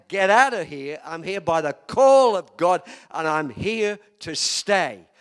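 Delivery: loud, drawn-out preacher's shout; defiant rebuke. Retold or imitated. Pastor alone in his office rebuking the devil, shouting defiantly. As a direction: thunder it out, punching each phrase.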